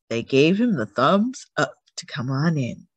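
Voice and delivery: ordinary delivery, nothing marked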